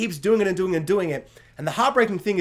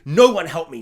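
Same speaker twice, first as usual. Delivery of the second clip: loud, aggressive mock shout; 7 dB louder than usual. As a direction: imitate her lashing out at the family. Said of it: "so violently, aggressively".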